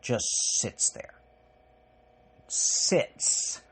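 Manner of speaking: whispered, hushed dread. Real, not acted. A parent telling viewers the dread just sits there, whispered. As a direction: breathe the words out in a hush. Quiet dread after the rant.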